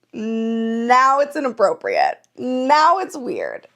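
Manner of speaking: slow, with a long-stretched "Now"; drawn-out rebuff. Retold or imitated. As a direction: drag out each "Now" for effect. Acts out rebuff after his proposal.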